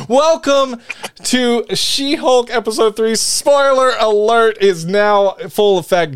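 big, high, drawn-out announcer call; hyped-up host kicking off the show. Real, not acted. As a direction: announce it grandly, stretching the words slowly. Announces the show after spoiler warning.

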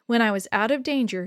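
ordinary delivery

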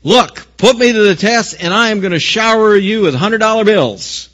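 high, loud showy promise; God promising. Acted. Preacher voicing what God did not say, loud high-pitched promise. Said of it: "He didn't say".

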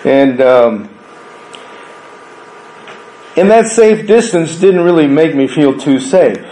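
ordinary talk, nothing marked

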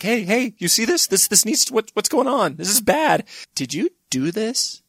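high, anxious dog whine; whining dog. Acted. Dog pawing at Hitler about the camps, high whining. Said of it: "start whining like".

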